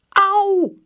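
high, loud yelp of pain; cry of pain. A narrator's reading. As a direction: yelp as the leg cracks. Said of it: "Pijn schiet door zijn lijf".

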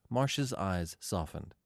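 usual speaking voice

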